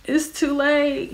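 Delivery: high, slow singsong refusal; imitated doctor. Doctor turning down laboring patient's epidural plea, imitated high and slow. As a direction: draw it out high, as a mock doctor.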